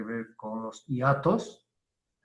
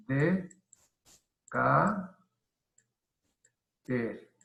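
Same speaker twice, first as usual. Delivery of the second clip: slow, separated syllables with long pauses; much slower than usual. Shown as a demonstration. Teacher showing syllable division to students, syllable by syllable. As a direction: say each syllable alone, pausing between. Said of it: "la separación silábica es".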